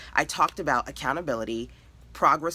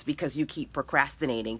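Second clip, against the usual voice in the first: normal talking voice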